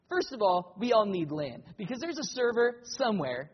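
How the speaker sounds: loud, pressing sermon delivery; emphatic preaching. Acted. Pastor arguing against internet church, emphatic preaching. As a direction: push the argument hard.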